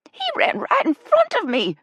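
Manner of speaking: very high, loud squeal; exclaiming. A narrator's reading. Ella reporting her Bigfoot sighting, excited squeal. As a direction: burst out in excitement. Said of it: "Ella exclaimed".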